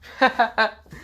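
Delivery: high, loud burst of laughter; real laugh. Real, not acted. Narrator laughs after Issei's money quip, amused. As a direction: crack up at your own joke.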